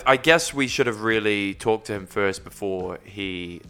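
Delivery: ordinary talk, nothing marked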